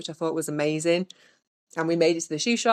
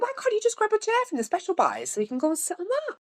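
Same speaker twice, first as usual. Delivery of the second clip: incredulous and much higher, mocking the guard's logic; sarcastic retort. To the security guard refusing a chair, sarcastic high retort. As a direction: needle him with a high, disbelieving question.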